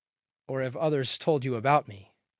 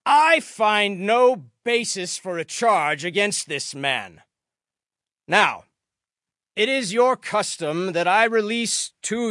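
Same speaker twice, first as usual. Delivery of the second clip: louder, higher, measured address; public proclamation; 5 semitones higher, 7 dB louder than usual. A narrator's reading. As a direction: project it out to a gathered crowd. Pilate addresses the gathered crowd.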